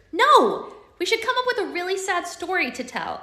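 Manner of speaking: high, loud "No!" then pleading; girl protesting her brother's cruel idea, loud and high; alarmed objection. Acted. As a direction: cut him off, shocked. Alex objects sharply.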